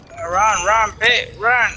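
rising urgent calls to run; urgent calling. Real, not acted. Urging teammate to run.

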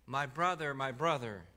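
put-on a small boy running to his brother, crying his name; a touch of a panicked child calling. A narrator's reading. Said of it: "ran to him crying".

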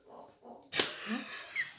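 breathy giggle at own French accent; laughing at own accent. Real, not acted. Reader laughing at her own French accent. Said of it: "Shall I abandon the accent?".

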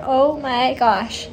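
ordinary talk

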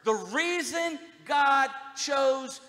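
loud, high preaching climax; preaching at full force. Real, not acted. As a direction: build it to a shouted peak.

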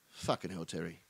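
very low, soft sigh of words; weary exasperation. Real, not acted. Comedian despairing of neighbour's joke, low weary sigh. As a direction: sigh it out under your breath. Sighs at Terry's bad joke.

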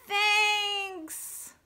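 storybook group thank-you, drawn out; chorus of thanks. A narrator's reading. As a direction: sing out gratitude on one stretched note. All the animals say thanks together.

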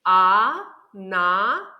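"А-на-нас" in three slow beats with clear pauses; split into syllables. Shown as a demonstration. Teacher counts syllables with children, "А-на-нас" slowly split by pauses. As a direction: break "А-на-нас" into drawn-out, separate syllables. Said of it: "Скільки складів у цьому слові".

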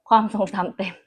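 ordinary delivery

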